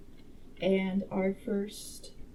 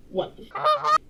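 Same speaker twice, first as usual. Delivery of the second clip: much higher, louder startled exclamation; shocked outburst; 8 dB louder than usual. Real, not acted. Reader reacting to the shocking ending, squealing in disbelief. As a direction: blurt it out in disbelief.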